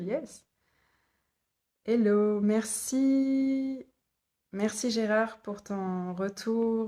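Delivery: ordinary talk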